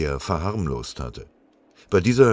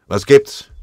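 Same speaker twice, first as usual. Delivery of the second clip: loud, higher, curt question; irritated; 8 semitones higher, 12 dB louder than usual. A narrator's reading. Sergeant to the arriving company runner, irritated. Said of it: "fragte er gereizt".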